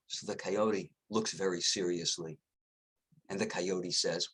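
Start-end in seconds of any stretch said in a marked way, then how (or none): none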